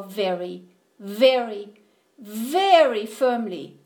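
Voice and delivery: "very" repeated with growing weight and pauses; stress on VERY. A narrator's reading. Bear shuts out the mouse, "very" heavily stressed. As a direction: lean harder and longer on each "very".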